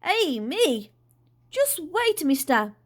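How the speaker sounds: excited calling. Acted.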